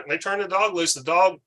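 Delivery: ordinary talk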